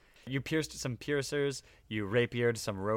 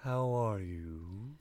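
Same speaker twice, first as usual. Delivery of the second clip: creature voice; much slower than usual. Acted. Voicing floating flump creature.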